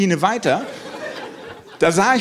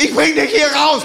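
higher, louder action-hero soldier; war-movie soldier; 9 semitones higher, 4 dB louder than usual. Retold or imitated. Niki in war mode rescuing his friend, loud soldier voice. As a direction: play the brave soldier from a war film. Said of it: "in so einen Kriegsmodus rein".

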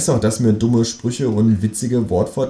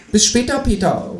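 high-pitched called farewell; kangaroo calling out. A narrator's reading. Kangaroo calling goodbye from the doorway, high-pitched. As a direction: call it back in a squeaky animal voice. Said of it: "ruft das Teutelbier".

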